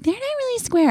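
high mock voice for a friend; mocking Spencer. Teasing host mocks her cohost's doubt, high mock voice. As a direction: pitch it way up to tease. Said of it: "even though Spencer was like".

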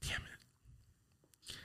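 barely audible, voiceless curse; real pained whisper. Real, not acted. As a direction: whisper it to yourself, overcome. Overcome while recounting the death.

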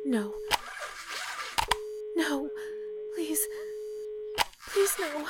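breaking, begging softly; pleading grief. Acted. Operator begging the silent line, grieving. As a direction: hold back tears. Realizing he is gone.

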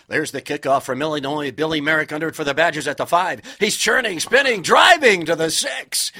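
loud, high, building radio football call; excited play-by-play. Retold or imitated. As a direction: call the run like an old radio announcer, climbing to the top. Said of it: "sportscaster then was ... Earl Gillespie".

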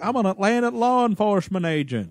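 hammed-up Southern accent; mock Southern accent. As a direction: imitate an actress's bad Southern accent. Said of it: "her Southern accent sounding".

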